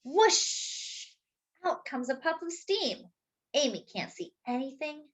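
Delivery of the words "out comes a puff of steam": ordinary delivery, nothing marked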